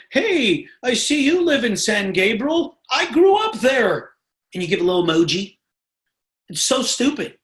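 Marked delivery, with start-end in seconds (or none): 0.1-4.1 higher, slowing mock cheerful voice; fake friendly opener. Seller pretending to share a hometown, higher mock chumminess. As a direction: ham up a try-hard stranger being chummy. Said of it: "trying to be like".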